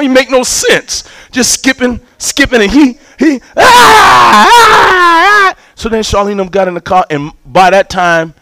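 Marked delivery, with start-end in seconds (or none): 3.6-5.6 long, high wailing scream; drawn-out mock tantrum shriek; imitated child screaming. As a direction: mimic an inconsolable toddler wailing. Imitating the boy's continued screaming.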